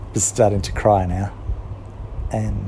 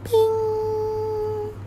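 very high bell-like ping; 4 dB quieter than usual. A narrator's reading. Magic bell sound as the present appears, very high ping. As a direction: ring it out like a tiny magic bell. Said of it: "a little sound like a bell".

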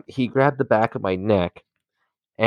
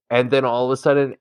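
usual speaking voice

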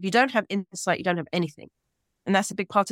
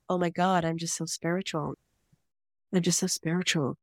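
a light, airy California "oh my God" voice; American accent, lightly mocking, softer; mock American valley accent; 4 dB quieter than usual. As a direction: parody a dreamy LA spiritual type in an American accent. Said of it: "I love the American accent".

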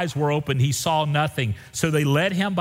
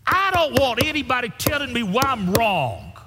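preaching climax; 12 semitones higher than usual. Acted.

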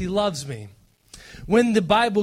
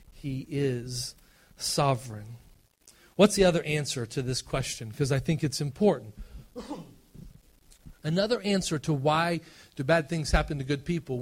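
ordinary talk, nothing marked

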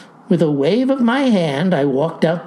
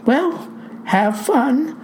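slow, higher-pitched, doubtful send-off; skeptical; 6 semitones higher, slower than usual. A narrator's reading. Clerk sends the farmer off to the dance, skeptical. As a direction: wish it slowly, unconvinced. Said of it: "sounding more and a little skeptical".

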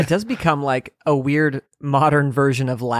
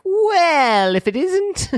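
high sly sinister drawl; evil villain voice; much slower than usual. Retold or imitated. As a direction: tip off the villain with your voice. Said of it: "you can tell a character is evil just by the voice".

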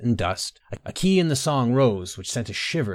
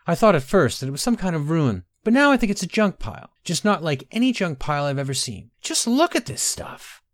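put-on higher, lively girl's voice; animated young explorer, puzzled; 7 semitones higher than usual. Girl explorer showing companions a strange junk pile, high and animated. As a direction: lift the pitch high with wonder at the find.

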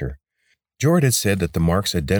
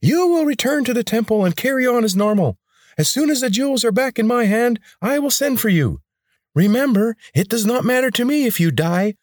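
much higher and louder, commanding; high grating rebel leader; 4 dB louder than usual. A narrator's reading. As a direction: bark orders in a high, grating voice.

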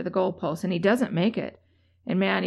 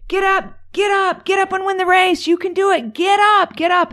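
high, loud yelled encouragement; cheering dad; 11 semitones higher, 5 dB louder than usual. Retold or imitated. Dad in the crowd urging his fallen son, loud high cheering. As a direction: shout him back onto his feet from the stands. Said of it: "there's his dad cheering him".

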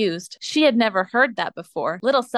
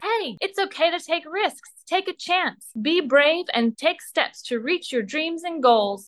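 bold bear voice; 7 semitones higher than usual. Acted. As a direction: play a gentle but daring bear urging her on. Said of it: "the boldest and most beary voice".

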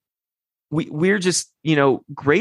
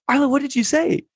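mock stunned dad; 11 semitones higher, faster than usual. Dad retells asking son.

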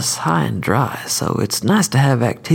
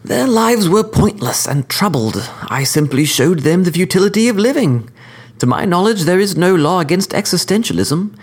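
higher, refined courteous assassin; cordial sophisticated killer; 7 semitones higher than usual. Acted. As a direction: lecture the court, smooth and polite. Skidmire's closing argument.

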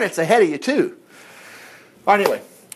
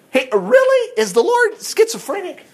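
much higher mocking question; high sarcastic retort; sarcastic disbelief; 11 semitones higher than usual. Real, not acted. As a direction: squeak it in mock disbelief. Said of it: "sarcastic retort".

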